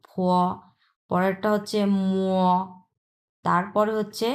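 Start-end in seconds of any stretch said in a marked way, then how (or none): 0.1-0.1 ফ drawn out as "phwo"; aspirated p with o-glide. Shown as a demonstration. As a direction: puff ফ from the lips and glide through a rounded o. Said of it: "এটাও হচ্ছে ওকারের মতো".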